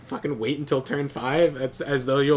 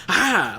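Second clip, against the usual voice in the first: mock cowboy yell; 6 semitones higher, 6 dB louder than usual. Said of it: "Like a quick draw cowboy".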